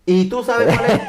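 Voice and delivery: can't hold back a laugh as he asks; half-laughing teaser to his friend; laughing question. Real, not acted. Laughing while telling his story.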